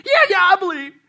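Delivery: loud, high, frantic agreement; desperate yelling. Acted. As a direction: shout back in panic. Desperate tourist answers the voice.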